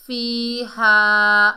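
फीहा loud, both vowels held long; elongated recitation. Shown as a demonstration. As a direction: chant "fee-haa" for फीहा very slowly. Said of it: "खींच कर पढ़ेंगे".

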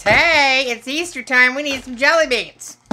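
gleeful holiday shout-out; excited cheer. Real, not acted. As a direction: announce it like a party's starting. Cheering about Easter candy.

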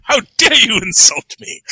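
hammed-up affronted gentleman; theatrical, put-on offence; mock indignation. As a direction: play at being gravely insulted. Imagined offended Ray scolding the speaker.

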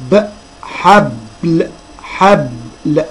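حبل very slow, split as "hab-l"; teacher sounding out حبل piece by piece; broken on the ب. Shown as a demonstration. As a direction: stop on the vowelless b, then release the l of حبل. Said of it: "نقولهم تاني".

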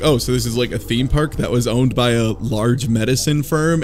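normal talking voice

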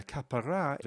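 "kapara" said ka-pa-RA, last syllable stressed; stress on final syllable. Shown as a demonstration. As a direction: hold back the stress until the end of "kapara". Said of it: "put the emphasis on the final syllable".

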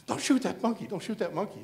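high-pitched imitated plea; mock pleading. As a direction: beg in someone else's voice. Ernie pleading, retold.

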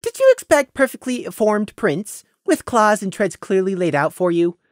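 high, sugary mock explanation; sweetly mocking. Prey sweetly mocking Scenic about badger tracks. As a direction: tease him sweetly like a child. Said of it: "sweetly mocking the earth pony".

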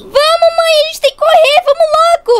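high, frantic urging; urgent panic. Acted. Girl rushing her mother to the rescue, urgent panic. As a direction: hurry her along, pitched right up.

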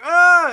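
mock startled scream, loud; startled yelp. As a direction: imitate a jumpy crowd yelping. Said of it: "everyone's like".

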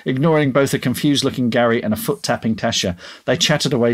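ordinary delivery, nothing marked

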